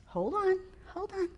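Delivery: high-pitched mock protest; small, high interjection; objecting listener.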